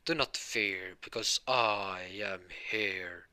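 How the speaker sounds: drawn-out heroic proclamation; All Might. Acted. Hero announces his arrival to everyone, grand and slow. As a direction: announce it slowly, stretching the last words. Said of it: "wait, that's All Might".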